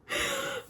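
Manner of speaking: a sudden squeaky laugh at herself; quick high giggle; high burst of laughter. Real, not acted. Laughing at her own past teasing of Kindles, a high giggle.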